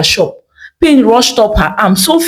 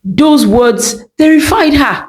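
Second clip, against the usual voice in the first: usual speaking voice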